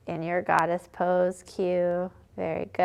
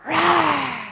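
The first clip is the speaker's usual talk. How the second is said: big high roar, as loud as possible; loudest lion roar; 8 semitones higher, 6 dB louder than usual. Shown as a demonstration. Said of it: "See how loud you can get".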